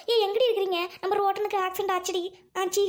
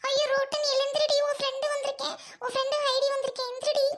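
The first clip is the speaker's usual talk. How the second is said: high-pitched, urgent pleading; pleading lament; 7 semitones higher than usual. Acted. Friend Heidi begging Rottan to wake, pleading wail. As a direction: beg an unconscious friend to get up.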